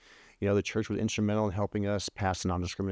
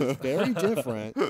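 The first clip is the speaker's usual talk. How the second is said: laughing; 4 semitones higher than usual. Retold or imitated. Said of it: "I'm like".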